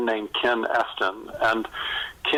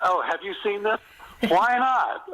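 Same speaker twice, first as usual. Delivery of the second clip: mock mentor; 9 semitones higher than usual. Mocking the mentor's demands.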